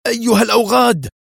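the curse explodes into a shriek; furious scream. A narrator's reading. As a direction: scream it out as the car spins. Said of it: "وهو يصرخ".

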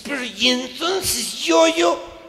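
high, slow rising yo yo; lost and pleading; confused husband whining; bewildered plea. Retold or imitated.

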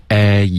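"A" held and glided towards ee; diphthong practice. Shown as a demonstration. Host echoing the teacher, drawn-out glide. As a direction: stretch "A" as a learner copying. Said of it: "change有一个双元音".

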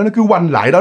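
usual speaking voice

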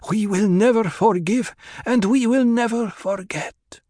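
high, emphatic harsh vow; harsh ritual vow. A narrator's reading. Hera uttering the ritual ending, harsh high vow. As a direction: pronounce it hard, pitched high. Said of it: "giving the words a harshness".

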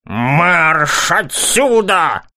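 slow, stern barked command; barking order. A narrator's reading. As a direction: bark it out, losing patience. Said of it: "рявкнул Лукас".

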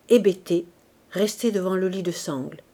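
usual speaking voice

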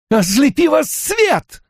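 high, slowed furious cry; shouting curse. A narrator's reading. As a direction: hurl the curse as she strikes. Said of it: "выкрикнула она".

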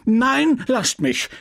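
furious, high outburst; apothecary raging at his family. Acted. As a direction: shove them off in a rage. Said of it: "tobte der Pharmazeut".

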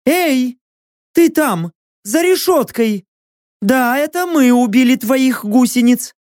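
high, insistent challenge; calling out. A narrator's reading. Kassandra questioning the unseen prisoner, high calling out. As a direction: call sharply to someone behind bars. Kassandra calls through the bars.

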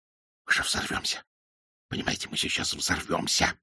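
soft voiceless panicked whisper; terrified whisper. A narrator's reading. Frightened man whispering to the pilot. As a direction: hiss the fear under the breath. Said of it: "Айсман перешёл на шёпот".

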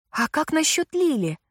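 high, loud sudden outburst; blurted out. A narrator's reading. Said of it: "выпалила я".

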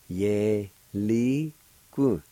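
ordinary delivery

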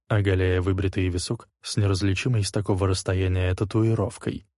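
usual speaking voice

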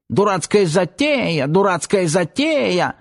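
high squeaky mimicking whine; squeaky mocking voice. A narrator's reading. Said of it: "писклявым голоском передразнил приятеля".

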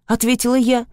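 ordinary delivery, nothing marked

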